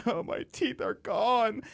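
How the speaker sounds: high, slow, hammed-up wail; mock sob about her teeth; mock crying. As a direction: pretend to weep over lost teeth. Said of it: "I'm not crying, like".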